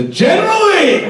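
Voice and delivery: triumphant mock holler; excited reveal. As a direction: shout the punchline like a grand unveiling. Big reveal ending the car anecdote.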